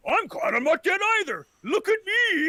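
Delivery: put-on worked-up elderly yelp; cranky old man. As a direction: crow indignantly like a ninety-year-old. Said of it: "why are you so mad".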